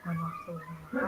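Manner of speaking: very faint, far from the mic; almost inaudible, drowned out; barely audible. Real, not acted. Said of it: "Maaf, Mbak, suaranya kecil sekali nih". (suara sangat pelan, hampir tak terdengar)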